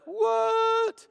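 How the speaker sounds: very high, drawn-out squeal; mock disbelief. Imagined Lazarus hearing he must return, mock disbelief. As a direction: squeal it out in comic shock. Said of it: "I'd be like".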